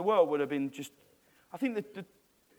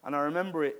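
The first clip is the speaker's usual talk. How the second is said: ordinary talk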